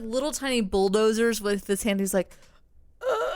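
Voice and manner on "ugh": high-pitched effortful grunt; strained high noise of stuffing cake in; mock straining groan; imitated eating noise. Imitates boy shovelling cake.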